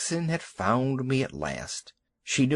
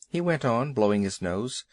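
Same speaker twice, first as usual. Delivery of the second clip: usual speaking voice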